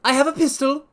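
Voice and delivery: very high, loud threatening squeak; frightened fat patient warning intruders, very high; reedy, quivering voice. Acted. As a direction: threaten from the dark in a high panicked voice. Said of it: "a reedy, quivering voice".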